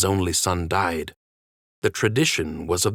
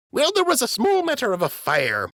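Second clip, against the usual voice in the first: put-on much higher, chirpy old dockhand; high-voiced dockhand greeting his captain; Jimmy the docker's voice. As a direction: pitch it way up like a simple dock worker.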